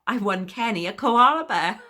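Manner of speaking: high, loud, breaking into a laugh; delighted laughing. Acted. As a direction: laugh with proud delight as you say it. Delighted at winning a prize.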